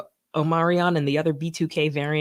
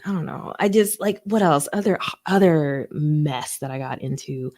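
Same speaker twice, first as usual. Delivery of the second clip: ordinary talk, nothing marked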